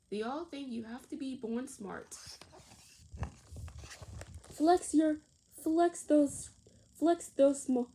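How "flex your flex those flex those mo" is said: louder, coaxing teacher call; rally the kids to flex, like a coach; teacher's cheer. A narrator's reading. Teacher urging children to flex muscles, loud and cheering. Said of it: "said Miss Mallory".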